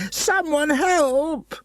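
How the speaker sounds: very high terrified call; frightened cry. Acted. Harvey crying for help, very high and frightened. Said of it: "yelled Harvey".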